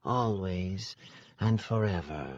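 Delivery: soft and wistful; small sad whisper. Acted. Sister's parting words to the guests, softly sad. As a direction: let it fade into sorrow. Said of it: "a small, sad whisper".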